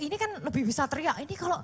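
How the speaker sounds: ordinary delivery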